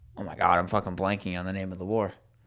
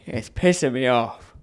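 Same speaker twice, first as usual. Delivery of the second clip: usual speaking voice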